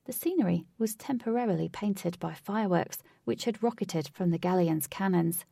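ordinary delivery, nothing marked

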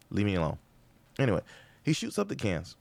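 ordinary talk

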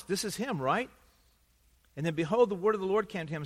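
ordinary talk, nothing marked

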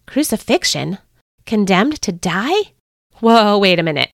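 alarmed questioning, building to a halt; shocked disbelief. Acted. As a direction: repeat it as if you can't believe it. Judas reacts to shocking news.

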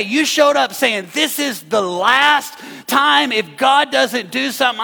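loud, high preacher build; preaching at full force. Acted. Pastor voicing David's complaint to the church, loud preaching. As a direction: drive David's complaint home.

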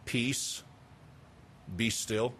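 very soft, low, understated command; simply said. Acted. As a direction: say it simply and almost under the breath. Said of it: "to witness Christ simply say".

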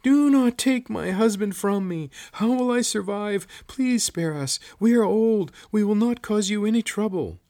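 very high, loud desperate pleading; thin shriek. A narrator's reading. Old woman begging soldiers to spare her husband, shrieking. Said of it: "thin shriek filled the air".

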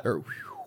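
a sharp, very high whistle at a passing woman; imitated wolf whistle. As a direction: whistle like a catcaller. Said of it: "when he whistles at Darlene".

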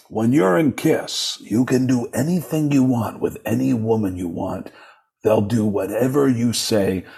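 [name]. Retold or imitated. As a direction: imitate a boastful rock star, stretching the words. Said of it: "And he goes".

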